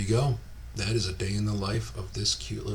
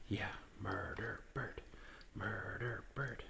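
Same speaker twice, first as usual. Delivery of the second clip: whisper; 3 semitones lower, 12 dB quieter than usual. Real, not acted. Whispered nickname to bird.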